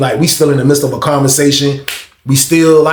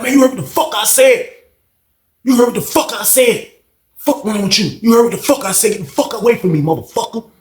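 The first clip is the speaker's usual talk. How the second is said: high, heated, repeated demands; angry yelling; 8 semitones higher than usual. Retold or imitated. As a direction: keep hammering him, still furious. Banks keeps yelling at K J.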